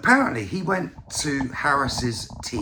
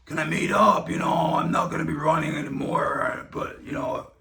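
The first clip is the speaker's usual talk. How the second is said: lightly voiced strained throat, mostly his own voice; a hint of a strained, raspy throat, trailing off; raspy Kennedy impression; 2 semitones higher than usual. Imitating Bobby Kennedy calling Trump, with a raspy throat. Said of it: "condition with his throat".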